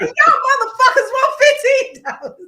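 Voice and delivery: high, loud laughing rage; furious outburst. Retold or imitated. Retelling yelling at the burger workers, laughing furious shout. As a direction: blow up at them, laughing through the fury. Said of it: "I was so pissed".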